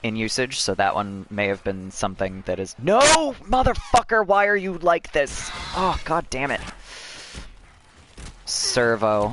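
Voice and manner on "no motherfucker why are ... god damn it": high-pitched, exasperated cursing; frustrated outburst. Real, not acted. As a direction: burst out in rage at the game. Game goes wrong mid-sentence.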